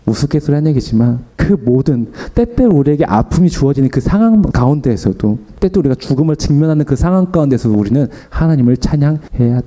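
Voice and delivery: usual speaking voice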